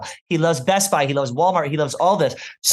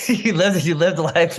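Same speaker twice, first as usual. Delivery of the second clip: laughing; 3 semitones higher, slower than usual. Real, not acted. Teasing co-host, laughing.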